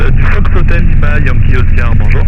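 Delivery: radio-filtered headset voice; over a pilot headset. Real, not acted. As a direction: talk like over an aviation radio.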